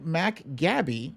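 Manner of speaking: ordinary delivery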